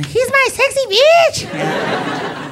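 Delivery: high, slow, laughing squeal of the wife; wife's squeal. Retold or imitated. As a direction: squeal it like his wife, drawn out. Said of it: "Liz's wife calls him Sexy".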